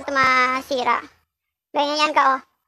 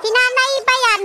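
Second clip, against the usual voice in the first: imitated viewer question; 8 semitones higher, 3 dB louder than usual. Voicing a viewer.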